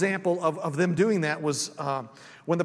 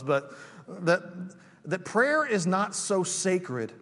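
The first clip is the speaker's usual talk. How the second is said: ordinary talk, nothing marked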